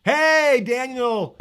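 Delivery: bursts out high and loud; loud greeting. Real, not acted. Teacher spots a friend in the chat, loud cheerful hello. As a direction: shout a happy hello to a friend.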